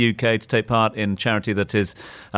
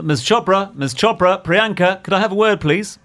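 higher-pitched, eager bid for a celebrity's attention; red carpet call-out; 9 semitones higher than usual. Retold or imitated. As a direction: re-enact calling a star over for an interview. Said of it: "That was him on the red carpet".

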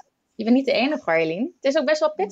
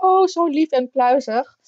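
cooing; 3 semitones higher, 4 dB louder, slower than usual. Retold or imitated. Retold cooing at puppies.

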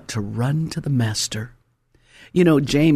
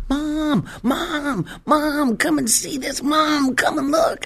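put-on child's excited yell; 13 semitones higher, slower than usual. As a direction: shout for Mom to come see, thrilled. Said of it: "he screams".

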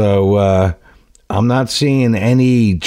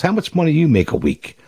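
hint of a demanding boss, pitched higher; boss questioning worker; 9 semitones higher than usual. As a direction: lightly act a boss putting a worker on the spot. Boss confronts worker in joke.

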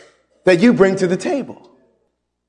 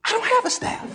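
laughing retort; 8 dB quieter, faster than usual. Real, not acted. Preacher laughs at his retort.

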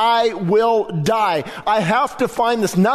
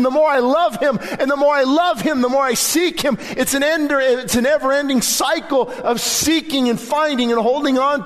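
raised, ringing preacher's voice, higher; impassioned preaching; 6 semitones higher than usual. Acted. Preacher urging his church to seek God, fervent and high. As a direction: lift the congregation with rising fervor. Preacher building to full force.

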